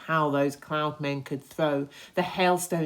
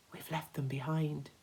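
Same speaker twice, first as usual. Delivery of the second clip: hushed relief; 7 dB quieter than usual. Acted. Relieved quiet realization.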